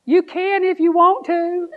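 put-on high, slow little-girl voice; teasing high child voice. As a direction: squeak it like a shy granddaughter. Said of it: "I heard this little voice".

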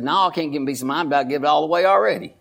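low, quick mock reply. Church member dodging advice, quick and low. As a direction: toss it off like a joking congregant. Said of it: "He said".

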